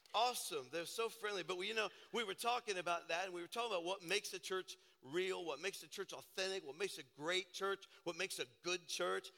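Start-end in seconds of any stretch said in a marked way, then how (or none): none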